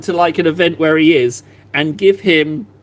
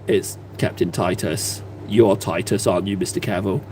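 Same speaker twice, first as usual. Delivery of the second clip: creepy fan; 7 semitones lower than usual. Retold or imitated. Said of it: "Be like".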